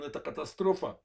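ordinary talk

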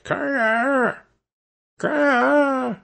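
high, drawn-out raven caw; two long high squawks, spaced apart; raven's croak. A narrator's reading. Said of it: "croaked and made a noise like".

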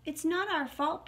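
high, protesting little mouse; piping baby mouse. A narrator's reading. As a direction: pipe up in self-defence. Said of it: "piped up the baby mouse".

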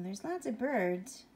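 plain reading voice

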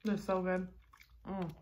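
chewing burrito while talking; speaking between bites; mouth full. Real, not acted. Seen 0: Holds burrito at mouth, chewing then biting while speaking.

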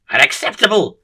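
loud, high outburst; screamed protest. A narrator's reading. Bin doctor bursting into the room, loud screamed protest. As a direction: burst in shouting your objection. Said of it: "screams the bin doctor".

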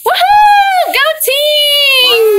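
loud, high, long-held crowd cheer; rooting cheer. Shown as a demonstration. Teacher shows the class how to root, cheering loudly. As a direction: whoop and yell for your team, voice way up. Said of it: "if I'm doing a good job rooting".

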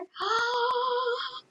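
long high gasp. Real, not acted. Streamer reads Journey to the Center, amazed gasp.

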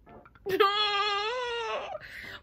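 long, high-pitched fake bawl; mock sobbing wail. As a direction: wail like an overdone breakdown. Mock breakdown after complaining.

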